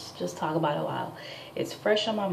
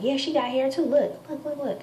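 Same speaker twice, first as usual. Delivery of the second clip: eager neighbor; 8 semitones higher than usual. Retold or imitated. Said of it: "She's like".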